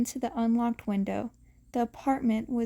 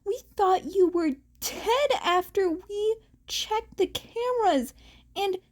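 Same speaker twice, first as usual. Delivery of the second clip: scolding yell; 9 semitones higher than usual. A narrator's reading. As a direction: tell him off hard. Mirako scolding.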